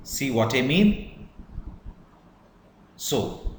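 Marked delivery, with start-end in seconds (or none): 0.0-1.3 question pitch. Shown as a demonstration. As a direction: lift "mean" sharply to ask it. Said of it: "or it's a question".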